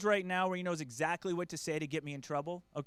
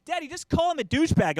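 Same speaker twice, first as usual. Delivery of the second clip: put-on kid daughter; 7 semitones higher, 6 dB louder than usual. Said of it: "my daughter ... just goes".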